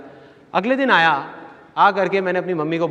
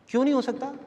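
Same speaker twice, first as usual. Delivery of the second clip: retold youngster's high-pitched challenge; protesting question; 8 semitones higher, 3 dB quieter, faster than usual. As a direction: ask why not, voice lifting in protest. Young man challenges elder's dismissal.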